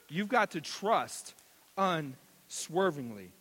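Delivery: ordinary delivery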